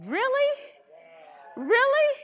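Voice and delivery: high, loud incredulous question; indignant squeal at a tattling brother; mock indignation.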